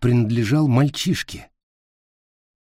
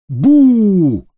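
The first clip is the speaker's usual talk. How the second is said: sudden loud boo, pitched way up; playful scare shout; 9 semitones higher, 8 dB louder than usual. Acted. Jumps out to scare troll.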